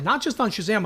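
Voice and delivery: ordinary talk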